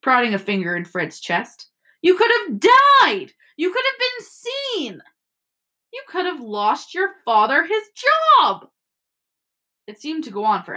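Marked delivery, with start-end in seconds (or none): 2.0-8.7 high, drawn-out wail of outrage; angry yelling. A narrator's reading. Mother berating her sons, yelling in outrage. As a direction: stretch the last word of each accusation up high. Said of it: "yelled Missus Weasley".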